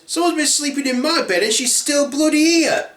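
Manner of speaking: high-pitched outraged cub; Baby Bear. Acted. Little bear finding someone still in bed, outraged. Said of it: "Baby Bear says".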